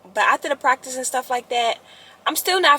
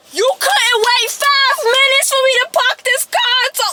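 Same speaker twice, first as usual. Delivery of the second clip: scolding the car; 11 semitones higher than usual. Retold or imitated. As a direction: chew out the broken car.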